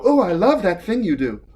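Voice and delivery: mock-swooning girlish admirer; admiring pretty girl. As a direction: coo it like a smitten fan, half laughing. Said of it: "when pretty girls go".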